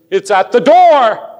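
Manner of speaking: loud, high prophetic cry; Old Testament prophet. Retold or imitated. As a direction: proclaim it like an urgent prophet. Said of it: "felt like one of the Old Testament prophets".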